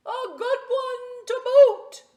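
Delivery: the crew cheering back; crew echoing. Acted. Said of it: "the others agreed".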